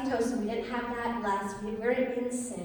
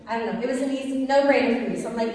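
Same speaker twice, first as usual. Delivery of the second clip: usual speaking voice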